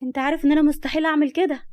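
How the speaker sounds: high, loud pleading denial; protesting denial. Acted. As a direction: protest your innocence urgently to the boss. Accused woman denies it to boss.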